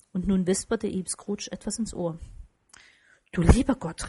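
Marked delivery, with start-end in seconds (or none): 3.3-4.1 louder, mildly stunned outburst; narrated gasp of amazement, understated; astonished exclamation. A narrator's reading. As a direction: exclaim as if taken aback. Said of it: "rief der Herr".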